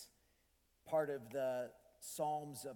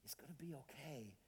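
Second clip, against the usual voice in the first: soft retold whisper to a stranger; a kind stranger whispers reassurance to the father; 12 dB quieter than usual. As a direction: hush it like a kind mother at the airport. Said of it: "she whispers".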